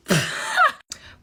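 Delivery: shrill high giggle; high squeal laugh. Real, not acted. Laughing at glasses.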